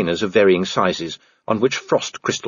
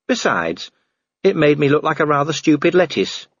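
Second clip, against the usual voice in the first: higher-pitched, respectful subordinate; earnest dwarf officer; 8 semitones higher than usual. Acted. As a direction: speak up earnestly in a raised pitch. Cheery explains about the dress.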